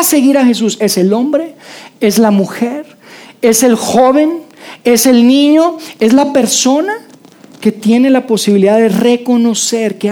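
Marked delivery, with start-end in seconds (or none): none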